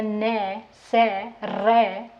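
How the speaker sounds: ने, से, रे drawn out slowly, each syllable alone; slow sounding out. Shown as a demonstration. Teacher slowly sounds out ने, से, रे for students. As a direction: say ने, से, रे very slowly for the class. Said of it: "आप बोल कर देखें".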